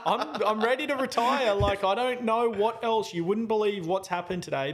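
high, loud venting in disbelief; exasperated outburst. Retold or imitated. Venting to Bonnie about an unbelievable day, retold exasperated outburst. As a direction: vent it high, pitch easing down by the end.

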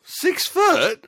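high surprised question; shocked at the number. Real, not acted. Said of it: "Oh, sorry, five foot".

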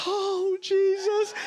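high, drawn-out comic plea; mock dismay. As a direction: cry out to heaven in mock panic. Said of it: "I'm like".

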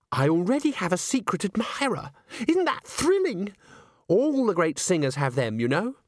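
put-on high, breathless-with-news girlish voice; gushing boast. A narrator's reading. Christine tells Agnes about her secret admirer, gushing. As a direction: swoop up high on the thrilling part.

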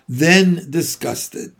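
ordinary delivery

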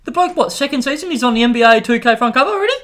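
high, disbelieving; can't believe it; incredulous. Real, not acted. Incredulous about Zion.

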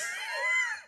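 shrill burst of laughter; high shrieking laugh; squealing laugh. Real, not acted.

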